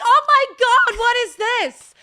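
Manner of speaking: high, loud burst of astonishment; amazed exclamation. Retold or imitated. British newcomer retelling first hearing country music, loudly amazed. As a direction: exclaim it as if blown away.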